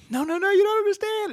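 very high cartoon sponge voice; high, frantic plea from SpongeBob to Sandy. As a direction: wail it like a frightened cartoon sponge.

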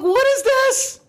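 normal talking voice

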